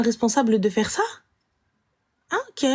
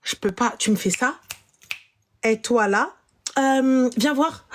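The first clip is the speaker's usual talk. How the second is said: usual speaking voice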